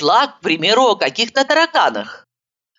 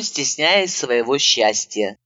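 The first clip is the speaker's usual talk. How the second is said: ordinary delivery, nothing marked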